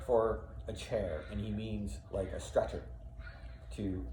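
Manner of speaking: usual speaking voice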